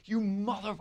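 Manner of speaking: high, hushed mock cursing; retold anger. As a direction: start a curse and cut it off. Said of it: "I was so mad at him".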